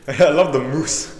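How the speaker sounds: laughing, loud and slow. Real, not acted. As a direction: giggle through the words. Laughing about the film.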